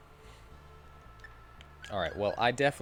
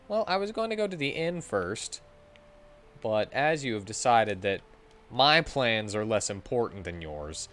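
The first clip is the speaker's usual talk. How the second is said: ordinary talk, nothing marked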